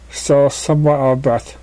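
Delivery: ordinary delivery, nothing marked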